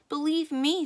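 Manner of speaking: high, loud furious howl. Acted. Slim howling as he charges at Sally. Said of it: "Slim howled".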